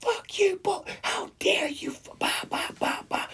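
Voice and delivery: high, strained mock rage; imitating her losing it, half choked; retold meltdown. Retelling the woman losing it at the guy, mock fury. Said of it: "she's fucking losing her shit on this guy".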